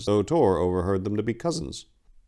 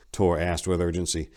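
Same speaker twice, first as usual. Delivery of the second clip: usual speaking voice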